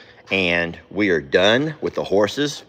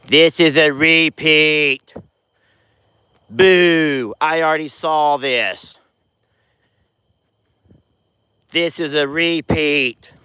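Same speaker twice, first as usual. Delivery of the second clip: slow, higher whiny mock commenter; 5 semitones higher, slower than usual. Vlogger mocking lazy viewer comments, slow whiny voice. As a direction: parody a bored, whiny viewer. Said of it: "Instead of".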